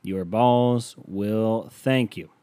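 very slow punchline slogan, spaced out; mock solemn ad tagline. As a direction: savour each word of the tagline. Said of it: "slogan delivery".

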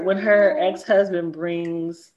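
normal talking voice

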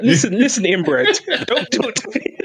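high-pitched, giggly telling-off; laughing mock comeback. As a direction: fire back a mock insult while laughing. Mock comeback to an imagined American.

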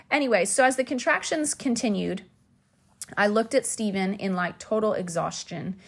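usual speaking voice

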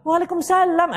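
high, lilting mock effeminate greeting; effeminate reply. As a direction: camp it up on the reply. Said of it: "ini bencong ini". (jawaban salam dengan suara banci yang dibuat-buat)

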